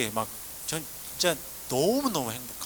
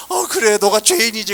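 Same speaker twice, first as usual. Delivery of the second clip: louder, mildly voiced kindly pastor; a hint of a gentle pastor playing along; youth pastor; 6 semitones higher, 10 dB louder than usual. Youth pastor gently agreeing with the sobbing boy, light imitation.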